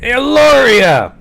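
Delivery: loud, drawn-out Brooklyn call; Brooklyn accent. Acted. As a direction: holler her name in thick Brooklyn. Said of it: "This is more of a Brooklyn accent".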